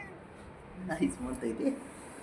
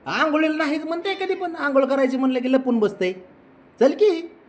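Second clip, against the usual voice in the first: much higher sing-song voice to the dog; pet talk; 13 semitones higher than usual. Real, not acted. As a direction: chide the dog sweetly, up high. Talking to pet in high voice.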